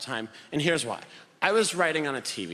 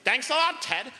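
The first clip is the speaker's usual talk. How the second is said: high, exasperated sarcastic thanks; pitched-up annoyed father; sarcastic dad; 9 semitones higher than usual. Retold or imitated. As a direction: snap it at the old man sarcastically. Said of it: "he's like".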